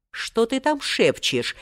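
put-on old man's wary query; suspicious. A narrator's reading. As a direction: ask slowly, full of suspicion. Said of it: "подозрительно спросил старик".

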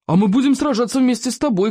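high, eager cry; shouting. A narrator's reading. Warrior boy pledges to fight beside the chief, shouting. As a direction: call it out high in support. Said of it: "крикнул Сморкало".

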